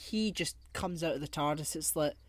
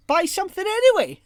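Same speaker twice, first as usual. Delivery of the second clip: much higher, much louder shout; joking shout; 15 dB louder than usual. Real, not acted. Shouting a cheeky plea to listeners to buy merch. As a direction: blurt the cheeky punchline.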